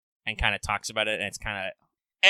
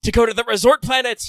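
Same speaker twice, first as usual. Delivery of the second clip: louder, higher, gung-ho declaration; eager vow; 7 semitones higher, 9 dB louder than usual. Acted. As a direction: proclaim the goal like a hero. Voicing MHXX's money quest.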